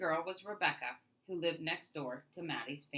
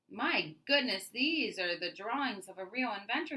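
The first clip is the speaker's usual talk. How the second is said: amazed praise; 5 semitones higher, 5 dB louder than usual. A narrator's reading. Mister Baldwin marvelling at Maddie's notebook, higher delighted wonder. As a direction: marvel at her drawings. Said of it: "he said".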